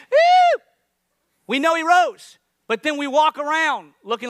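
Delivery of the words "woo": very high, loud woo; worship woo. Retold or imitated. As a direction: cheer like in worship. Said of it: "We'll sing".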